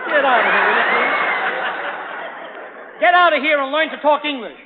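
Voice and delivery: hammed-up irritation; mock exasperation. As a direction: shoo him away in mock annoyance. Comic exasperated dismissal.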